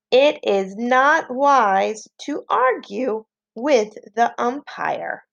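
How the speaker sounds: slow, continuous sentence reading for pupils; slow dictation. Shown as a demonstration. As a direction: dictate it slowly as one phrase. Teacher repeats the sentence for pupils.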